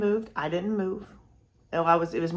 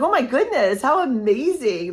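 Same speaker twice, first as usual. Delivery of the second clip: higher and louder, gushing; delighted exclaim; 5 semitones higher, 6 dB louder than usual. Retold or imitated. Answering the woman at the TSA line, excited gushing. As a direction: burst out with happy amazement. Excited reply to stranger's praise.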